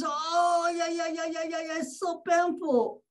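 high, rapid yelping of a patient in pain; imitated pained scream. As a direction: yelp as if the sore spot was pressed. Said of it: "she screams".

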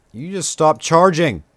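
loud, high, slow yell at the screen; real gamer rage shout; frustrated shout. Real, not acted. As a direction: snap the order out, slow and loud. Yelling at dragon recharging.

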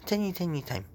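a playful Chinese voice through the filter; put-on Chinese voice. Showing viewers a voice filter, a light Chinese accent. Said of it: "now I'm really Chinese".

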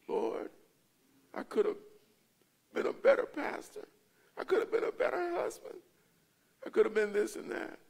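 tearful regret. Acted. Acting out regret before the Lord.

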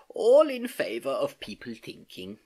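slow, sleepy approval; drowsy yawn. A narrator's reading. Uncle dozing while niece talks, sleepy. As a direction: drawl it drowsily after a yawn. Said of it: "he yawned".

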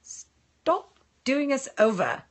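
slow, drawn-out British accent. Shown as a demonstration. Trying the viewer's comment for Barry, British accent. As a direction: try it in a careful British accent. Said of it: "do that in a British accent".

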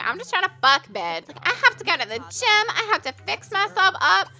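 lightly high mock guy voice; a hint of a whiny boyfriend, rushed; voicing the guy sim. Player voicing the boyfriend sim brushing her off, mock high voice. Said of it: "He's like".

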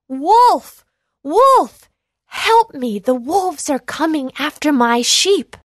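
high, loud yell for help. A narrator's reading. Boy yelling to villagers for help, loud yell. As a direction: shout it with all his might. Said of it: "He yelled out with all his might".

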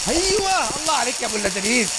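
elated gamer praise; excited cheer. Real, not acted. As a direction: celebrate your own success, stretching the first word. Cheering own success in game.

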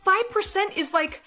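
high, loud fretting; panicked whining. Acted. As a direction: wail it like a spoiled daughter in crisis. Spoiled daughter panics over score.

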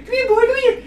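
very high, loud coaxing squeal; squeaky dog call. Pretend.

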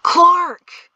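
sudden high, loud cry of recognition; astonished gasp. A narrator's reading. Rider spots own horse in the dark, astonished gasp. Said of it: "then gasped".

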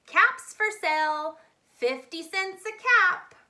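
loud, high street call. A narrator's reading. Peddler calling out his caps for sale, loud. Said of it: "he walked back to town calling".

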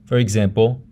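normal talking voice

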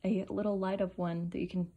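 ordinary talk, nothing marked

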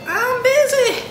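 high-pitched holler at the phone; sing-song call-out. Real, not acted. Calls out to the ringing phone.

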